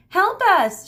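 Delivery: very high, loud shout. Acted. Cassandra shouting for help across the chasm, loud high yell. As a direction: yell for help at full force. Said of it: "shouted Cassandra".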